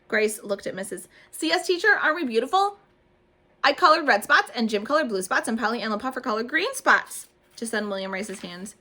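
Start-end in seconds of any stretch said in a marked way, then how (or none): 1.3-7.3 high, loud gushing; excited girl. A narrator's reading. Grace gushing to Junie about the dots, high and loud. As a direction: tumble the story out.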